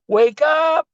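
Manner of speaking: very high, bright wake-up call; squeaky high wake-up voice. Shown as a demonstration. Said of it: "let's use that voice to say".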